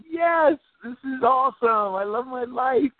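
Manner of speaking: high, elated cheer; excited celebration. Pretend. Coach voicing the client celebrating, high and elated. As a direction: burst out with joy at your life.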